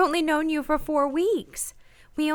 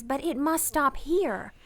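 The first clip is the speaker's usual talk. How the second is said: normal talking voice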